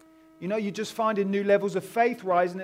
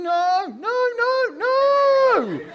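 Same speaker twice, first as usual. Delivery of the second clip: put-on high, loud child's panicked cry, rising higher; panicking little girl; 8 dB louder, much slower than usual. Little girl on the preacher's shoulders panicking, high and loud. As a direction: squeal it like a frightened little girl.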